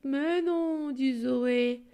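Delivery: lively storybook reading; expressive children's story reading. Acted. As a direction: read the story aloud to the class.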